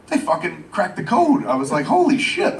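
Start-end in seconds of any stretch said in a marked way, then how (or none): 1.9-2.6 high-pitched swoop of amazement; amazed. Retold or imitated. Man sees screen capture of King Shark, amazed. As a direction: react to a jaw-dropping picture.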